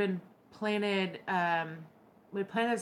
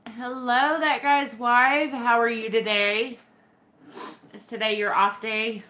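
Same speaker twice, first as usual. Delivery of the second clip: bright greeting; 4 semitones higher, 8 dB louder than usual. Real, not acted. Vlogger greeting her husband's wife, cheerful and louder. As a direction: greet her warmly and loud.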